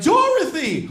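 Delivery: high-pitched mock call for a lost child. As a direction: call her name out, acted. Aunt calling out for missing girl.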